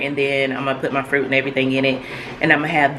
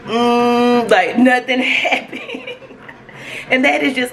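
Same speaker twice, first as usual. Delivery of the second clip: laughing; 7 semitones higher, slower than usual. Real, not acted. Laughing at boring vlog.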